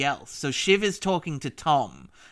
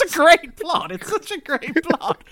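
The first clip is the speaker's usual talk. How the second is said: high and loud through the laughter; laughing delight; 13 semitones higher, 5 dB louder than usual. Real, not acted. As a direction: gush about the plot while still laughing. Laughing delight at Greg's plot.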